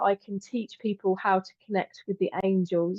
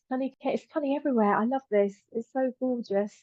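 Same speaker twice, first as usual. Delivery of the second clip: normal talking voice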